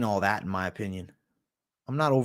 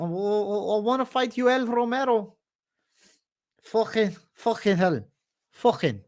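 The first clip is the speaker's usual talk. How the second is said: high-pitched, drawn-out mock Scouse accent; Darren Till; 9 semitones higher, slower than usual. Streamer doing a requested fighter impression, high Scouse accent. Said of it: "Darren Till impression".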